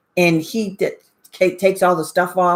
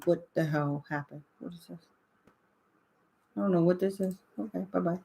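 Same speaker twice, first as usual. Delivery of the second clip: muttered aside; 4 semitones lower, 13 dB quieter than usual. Real, not acted. Muttering at computer glitch.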